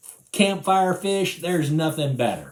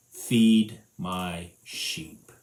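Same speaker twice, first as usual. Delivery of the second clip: very slow, low, tender command; slow gentle command; 5 semitones lower, much slower than usual. Acted. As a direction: let it settle slowly. Jesus final command.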